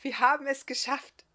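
high, loud triumphant exclamation; enthusiastic. A narrator's reading. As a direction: exclaim it with delight. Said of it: "rief Lichtina begeistert".

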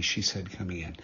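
usual speaking voice

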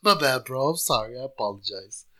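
fading mock apology. Mock apology getting quieter.

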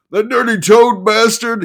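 high, loud groaning cry; loud anguished groan. Acted. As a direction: moan it out in anguish. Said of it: "he groaned".

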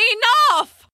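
high, loud exasperated shout. Acted. Sleepless owl shouts at bragging grasshopper, exasperated shout. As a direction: snap it out at full force. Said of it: "Miss Owl found herself shouting".

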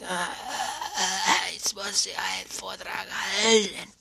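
croaking, whispery struggle to get words out; ruined voice. Acted. Speaker with an unspared voice facing an important Vortrag, croaky ruined voice. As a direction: imitate a speaker whose voice has given out. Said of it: "Du hast deine Stimme nicht geschont".

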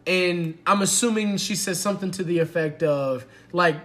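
ordinary delivery

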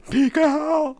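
high strained gasp; gasping Big Al. Acted. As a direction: squeak the name out. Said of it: "mine's hitting too low".

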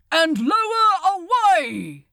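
a high, loud barked command; yelled order. A narrator's reading. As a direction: bark the order over the crowd. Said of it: "yelled Wild".

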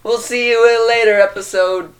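high, loud mock sign-off; announcer voice. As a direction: sign off like a show host.

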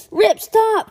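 high, loud urgent call; alarmed cry. Acted. Bus driver pulling the boy back, loud cry. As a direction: stop him loudly. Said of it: "cried Mister Horsley".

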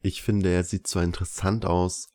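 plain reading voice

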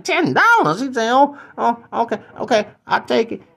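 high, halting disbelief; squeaky customer gulping at the cost; shocked stammer. Acted. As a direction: stammer over the price. Shocked at price.

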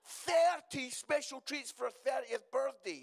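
thirty special treats punched out loud and high. Shown as a demonstration. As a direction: announce it proudly to the room. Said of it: "And, uh".

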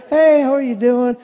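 slow, drawn-out friendly pastor greeting; forced pastorly niceness; Joel Osteen. Retold or imitated. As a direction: put on a televangelist's pleasant hello. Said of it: "I'm trying to Joel Osteen".

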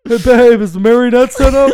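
a hint of a playful lover calling out; mock partner voice. Mock couple scene with sex robot.

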